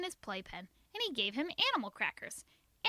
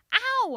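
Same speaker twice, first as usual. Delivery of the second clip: sharp ow; 12 semitones higher, 7 dB louder than usual. A narrator's reading. Girl echoing the pinch.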